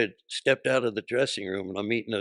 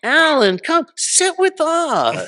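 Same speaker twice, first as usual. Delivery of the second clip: piercing high, drawn-out holler; very loud, stretched mock-friendly call; imitating Mitzi; 13 dB louder, much slower than usual. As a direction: sing out the invitation at full volume. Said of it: "That's how mean her sense of humor was".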